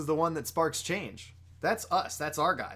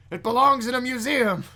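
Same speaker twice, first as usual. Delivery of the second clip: loud, high movie-hero outcry; Harrison Ford; 10 semitones higher, 8 dB louder than usual. Acted. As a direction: shout it as an indignant film hero. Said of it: "Now I'm just doing Harrison Ford".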